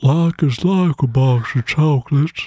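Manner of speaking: creepy lisped drawl; Forrest Gump. Retold or imitated. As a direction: do a lisping, slow Southern simpleton impression. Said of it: "Think more Forrest Gump".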